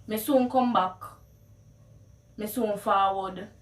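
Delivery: casual Patois promise; easy Jamaican Patois. Shown as a demonstration. Promising a friend to return, in Jamaican Patois. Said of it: "how you would translate the words".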